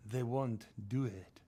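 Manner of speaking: put-on slow, heavy Chechen accent with freak said as frig; slow Chechen gangster accent. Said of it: "And the Chechen says".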